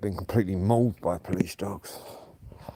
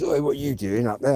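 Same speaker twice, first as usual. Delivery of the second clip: a pretend stranger calling out, higher and louder, "Oi" rising; mock challenge; 5 semitones higher, 4 dB louder than usual. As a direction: shout it like someone catching him. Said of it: "if anyone jumps out and goes".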